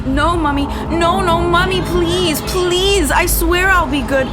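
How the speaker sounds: put-on high, desperate little-girl begging; whimpering plea. Frightened girl begging her mother not to hurt her, whimpering. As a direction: plead through fear like a frightened child. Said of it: "With a whimper".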